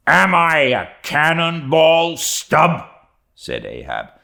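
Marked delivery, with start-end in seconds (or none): 0.1-2.9 loud, high-pitched indignant challenge; scornful outburst. A narrator's reading. Old Ahab turning on his second mate, scornful shout. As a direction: throw it out as a scornful roar. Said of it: "scornful old man".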